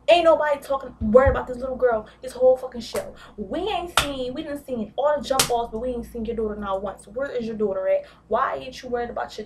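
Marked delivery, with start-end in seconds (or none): 0.1-5.2 loud, fed-up rant; exasperated shout. Real, not acted. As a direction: yell it, outraged at the neglect. Ranting about the daughter.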